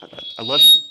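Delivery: a sudden loud squeal of love; squealed love you. Retold or imitated. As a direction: squeal it out like a giddy kid. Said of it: "Minus the squeal".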